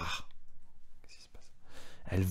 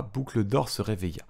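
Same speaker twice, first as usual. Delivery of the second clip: ordinary delivery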